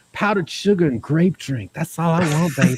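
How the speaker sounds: high, crooning mock lover; smooth Chris. Chris sweet-talking for powdered sugar, crooning. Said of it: "Chris is like".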